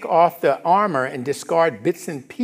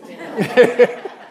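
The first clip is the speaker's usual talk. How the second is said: loud high laugh at himself; burst of laughter; 8 semitones higher, 6 dB louder than usual. Real, not acted. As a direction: laugh out at your own story. Laughing at own dream story.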